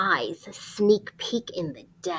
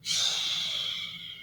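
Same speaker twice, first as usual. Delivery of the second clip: shushing; 9 dB quieter than usual. Acted. Modelling snoring hush.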